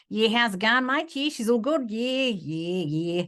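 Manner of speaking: broad Australian bloke, rising on mate; mock Australian, trailing yeah yeah yeah; comic Aussie mimicry. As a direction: mimic a laid-back Aussie for laughs. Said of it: "mimicking others for comedic effect".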